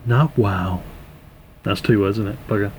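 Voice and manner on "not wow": Owen Wilson voice. Pretend. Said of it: "In the voice of Owen Wilson".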